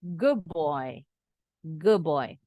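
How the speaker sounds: put-on Good boy as "goob boy"; D becomes B in Good boy; Good boy blended with a doubled B; assimilated D. Shown as a demonstration. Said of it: "D-nya berubah bunyi jadi sama seperti B".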